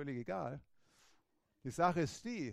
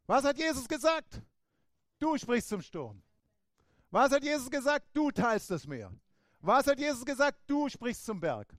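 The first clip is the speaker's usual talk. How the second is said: higher, louder, firm self-rebuke; commanding own soul; 8 semitones higher, 9 dB louder than usual. Pretend. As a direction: order your soul to stop panicking. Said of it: "dass du dir dann bewusst wirst".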